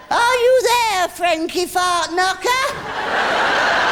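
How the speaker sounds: high, loud and drawn out. Shown as a demonstration. Medium calling the dead at a seance, loud high voice. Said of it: "say after me in a very loud voice".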